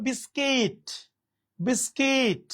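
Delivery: "বিস্কিট" drawn out on the final -kit; correct pronunciation. Shown as a demonstration. Teacher modelling it for learners, "বিস্কিট" with a long -kit. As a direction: say "বিস্কিট" as bis-keet, i not u. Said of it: "উচ্চারণটা মূলত হবে".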